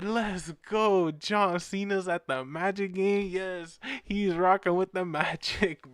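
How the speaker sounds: mock childlike celebration; excited kid. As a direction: retell it as a thrilled little kid. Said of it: "I was such a kid".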